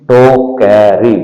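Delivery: टोकरी drawn out slowly; धीरे टोकरी; slow sounding out. Shown as a demonstration. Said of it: "धीरे धीरे बोलिए".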